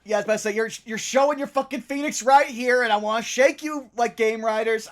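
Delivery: loud, high-pitched ranting; heated exasperation. Real, not acted. Venting at a game character.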